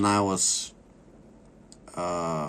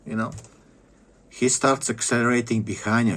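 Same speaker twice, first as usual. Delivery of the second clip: usual speaking voice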